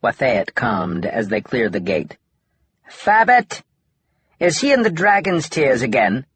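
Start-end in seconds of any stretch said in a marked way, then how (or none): 2.9-6.2 high, loud alien voice calling out; a worried alien shrieking a question; alien cry. A narrator's reading. Alien frets to Drath about Fabbit, high loud alien shriek.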